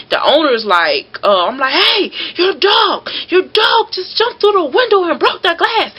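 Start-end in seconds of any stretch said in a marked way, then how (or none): 1.7-5.9 much higher, frightened cry at the owner; panicked yell. Retold or imitated. Mail carrier yelling at owner after dog breaks window, much higher panicked cry. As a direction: shriek the news in panic. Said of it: "So now I done ran screaming".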